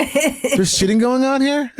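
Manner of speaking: high, drawn-out incredulous question through laughter; hammed-up astonishment, slow and high; mock disbelief. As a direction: play shocked, voice climbing, half laughing. Mock shocked store staff, laughing.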